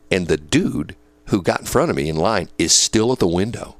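ordinary talk, nothing marked

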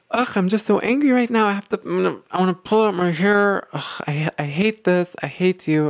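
hammed-up angry outburst, pitched high; angry venting. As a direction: play a worked-up partner venting in exasperation. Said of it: "let's say that someone's very, very angry".

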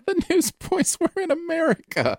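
high, slow, squeezed out through laughter; helpless real laughter in the words; laughing. Real, not acted. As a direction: talk while cracking up. Laughing at co-host's newsboys mistake.

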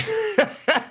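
very high squeal of laughter. Real, not acted. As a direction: shriek with laughter. Laughing at the joke.

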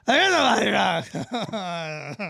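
a loud burst of laughter; hearty laugh. Real, not acted. Laughs after fake denial.

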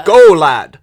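very loud, urgent order to go; urgent shout. Acted. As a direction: yell it to send him running. Digger urges hero to run.

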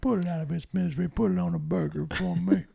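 high, quick granny voice; grandma voice. Acted. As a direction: ask sweetly like a grandma. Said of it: "grandma voice".

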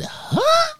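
very high squeaky huh; shrieked questioning huh; incredulous huh. Retold or imitated. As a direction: squeal it in mock disbelief. Incredulous reply.

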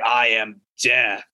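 slow, low menacing death voice; grim reaper voice. Retold or imitated. Villain voiced in comic retelling.

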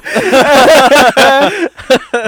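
loud high burst of laughter; big laugh. Real, not acted. Laughing after cursing joke.

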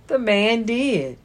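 ordinary delivery, nothing marked

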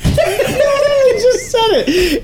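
high, slow laughing through the words. Real, not acted. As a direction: laugh while admitting the slip. Laughing at own flub.

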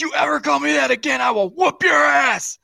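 high, strained shouted threat; high-pitched retold tirade; mock furious mom. Mother's threat after the beating.

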